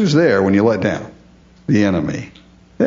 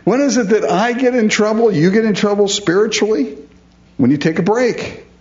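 emphatic preaching; 9 semitones higher than usual. Real, not acted.

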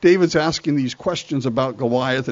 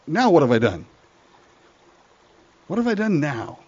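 exasperated little brother; 2 semitones higher than usual. Acted. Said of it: "David looks at his brother and says".

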